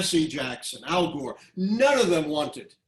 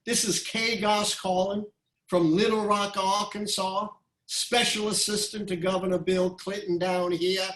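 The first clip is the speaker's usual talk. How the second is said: put-on Southern accent; 5 semitones higher than usual. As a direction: imitate a Southern caller introducing herself, pitched high. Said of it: "the Southern accent seems to disappear".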